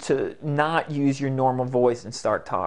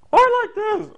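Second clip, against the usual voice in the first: loud, squeaky-high silly voice; sky-high, loud comic squeal; very high funny voice; 6 dB louder, faster than usual. Shown as a demonstration. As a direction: leap into a piercingly high goofy voice. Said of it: "I'm now gonna talk like this".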